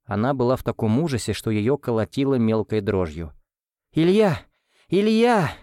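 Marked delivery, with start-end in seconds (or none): 3.9-5.5 loud, high cry of a name, running; shouting. A narrator's reading. Frightened nanny calls Ilya while running, shouting. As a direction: call out his name in alarm. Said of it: "закричала она на бегу".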